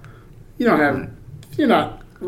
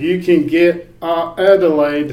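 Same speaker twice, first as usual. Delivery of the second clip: higher, louder, drawn-out fake Australian; mock Australian accent; 5 semitones higher, 6 dB louder, slower than usual. Server offering fire-themed spice levels, mock Australian accent. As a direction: stretch it out in a hammy Australian accent.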